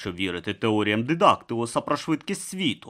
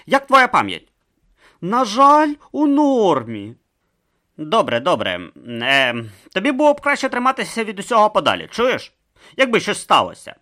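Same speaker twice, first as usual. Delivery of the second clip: higher, louder dialogue voices; character voices; 12 semitones higher, 6 dB louder than usual. Acted. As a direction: act the conspirators' exchange above the narration. Voiced dialogue Urn and Brutha.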